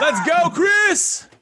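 high, rallying hype call; excited high cheer to a guest; fired up. Real, not acted. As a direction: pump up the guest, pitch soaring. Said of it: "I need to get up to your level".

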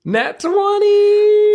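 high drawn-out cheer; excited shout. Real, not acted. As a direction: yell it out in triumph. Player shouts a natural twenty.

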